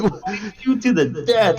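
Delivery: high hammed-up villain threat; a high, cartoonish threat; mock Penguin threat. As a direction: threaten him in a silly high voice.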